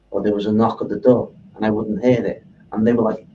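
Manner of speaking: ordinary talk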